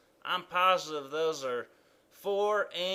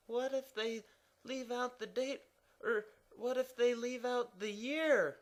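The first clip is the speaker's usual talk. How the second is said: higher and softer worried questions; anxious question mark; 8 semitones higher, 6 dB quieter than usual. Acted. Quint worries about missing dates, softly anxious. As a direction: fret over each case.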